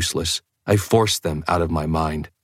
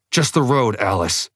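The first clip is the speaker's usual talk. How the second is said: tense, high, sharp command; spat through teeth; 5 semitones higher than usual. A narrator's reading. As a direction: spit it through clenched teeth. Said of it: "I spit through my teeth".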